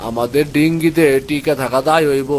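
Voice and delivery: ordinary talk, nothing marked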